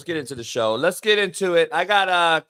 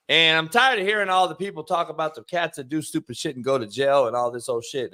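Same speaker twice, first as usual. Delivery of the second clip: normal talking voice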